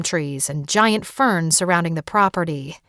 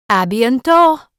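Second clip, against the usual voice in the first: louder, higher French À bientôt, called out; French farewell; 5 semitones higher, 7 dB louder, slower than usual. Acted. Rosalie calls goodbye to Erin in French, louder and higher. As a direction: call out À bientôt like a native. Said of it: "in a thick French accent".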